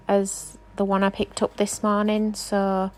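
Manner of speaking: usual speaking voice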